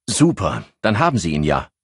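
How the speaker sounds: brightening at good news; pleased. A narrator's reading. Henri on the phone hears Himmel was found, pleased. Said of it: "freute Henri sich".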